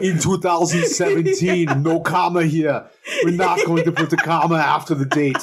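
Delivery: put-on type aloud in a heavy Austrian accent; Schwarzenegger accent. Co-host plays the star typing the opening scroll, Schwarzenegger accent. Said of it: "He typed it up in his accent".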